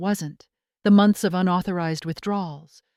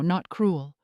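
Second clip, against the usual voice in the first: usual speaking voice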